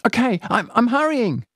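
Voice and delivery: high rushed reply; flustered girl. Acted. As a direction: answer mid-effort. Companion flustered.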